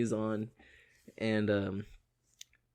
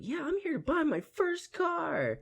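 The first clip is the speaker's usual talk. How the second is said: put-on much higher, slow boyish voice; young teen kid. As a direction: play a nervous fourteen-year-old. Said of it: "the kid sounded like he was, like, fourteen".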